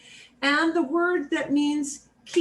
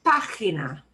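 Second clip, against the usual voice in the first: Página as "PAH-khee-nah", first syllable punched; stress on PA; 5 semitones lower than usual. Shown as a demonstration. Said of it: "that accent on the ah means we punch that".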